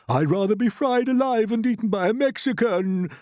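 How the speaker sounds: high, loud wail. A narrator's reading. Grasshopper dreading the cloud men, wailing. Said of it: "wailed the old green grasshopper".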